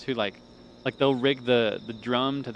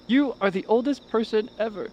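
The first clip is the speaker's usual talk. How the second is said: higher, louder, emphatic judge proclamation; talent show judge; 9 semitones higher, 4 dB louder than usual. Retold or imitated. Parodying a talent show judge's famous line, hammed-up proclamation. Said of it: "the clip from America's Got Talent".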